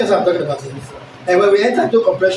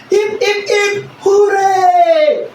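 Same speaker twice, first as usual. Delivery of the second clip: high, loud, slow drawn-out cheer; 12 semitones higher, 4 dB louder, much slower than usual. Shown as a demonstration. Teacher demonstrating an exclamation with a cheer. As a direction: cheer it like a crowd celebrating. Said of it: "What are you going to put there?".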